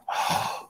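sharp acted intake of breath; mock frightened gasp. Camper hears something enter the water, gasping.

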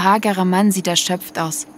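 ordinary delivery, nothing marked

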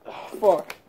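usual speaking voice

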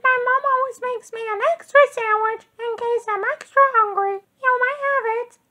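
squeaky Betty Lo reassuring. A narrator's reading. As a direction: comfort him like a sweet small girl. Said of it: "said Betty Lo".

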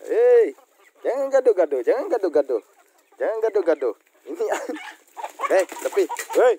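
loud scolding of squabbling animals. Real, not acted. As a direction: shout them apart. (menegur keras hewan yang berkelahi)

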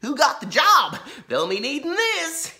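high, loud cheery sheriff voice; blithely helpful; naive old sheriff. A narrator's reading. Laura's dad offers his badge, high and cheery.

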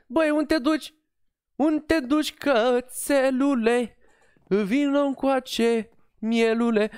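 high, slow sing-song call to the dog; high drawn-out pet talk; cooing to a puppy. Real, not acted. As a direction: coax the little dog over like a pet owner. Calling the puppy in-game.

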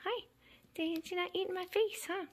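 high, teasing kitten voice; pet talk. Real, not acted. Kitten going at her face, high pet talk.

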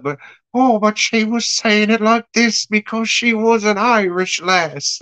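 high-pitched, badly faked Irish lass; terrible Irish accent. Film reviewer mimicking the wife as an Irish lass, terrible Irish accent. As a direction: put on a hopeless, hammed-up Irish accent. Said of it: "that was the worst fucking accent ever".

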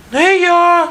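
very high squeaky mouse voice; little mouse refusing. A narrator's reading. Mouse refuses the rooster's chore, squeaky voice. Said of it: "отказує одне мишеня".

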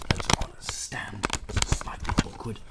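slow half-whisper; whispered aside. Real, not acted. Fiddling with the camera, whispering to himself. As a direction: mutter it under your breath.

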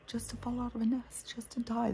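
hushed whisper. Real, not acted. Telling the viewer about the baby bird's fate, whispering. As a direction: breathe it out as a whisper.

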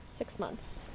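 "Six months" whispered again, breathy and very soft; whispered repeat. Real, not acted.

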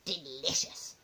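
low, soft "Delicious"; hushed, gloating "Delicious"; savouring grandma. A narrator's reading. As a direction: murmur "Delicious" to yourself with relish. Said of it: "she said again".

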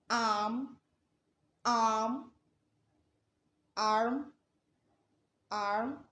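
"arm" with the American r pronounced, word by word; r sounded, American. Shown as a demonstration. Teacher giving US examples, "arm" with r pronounced. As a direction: say each "arm" separately in a US accent, sounding the r. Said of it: "r sound is always".

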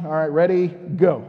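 ordinary talk, nothing marked

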